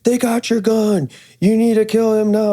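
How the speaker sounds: ape the instructor screaming at a student; imitating a screaming instructor. Instructor screaming at a student, mock yell. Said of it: "scream at the student".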